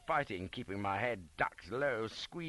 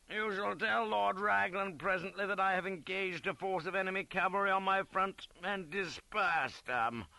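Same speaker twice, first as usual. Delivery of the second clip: high, bawled officer's dispatch; bawling; 8 semitones higher than usual. A narrator's reading. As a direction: bawl it out like a pompous old commander. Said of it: "bawls he".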